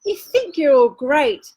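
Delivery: a hint of a bellowing elephant. A narrator's reading. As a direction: boom it out like an elephant. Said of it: "The elephant bellowed".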